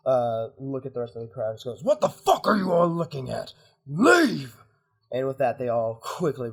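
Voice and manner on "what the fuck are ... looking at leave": high, loud commanding yell; furious bellowed dismissal; angry shout. Acted. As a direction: drive the whole crowd away. Commander yells at the crowd to scatter.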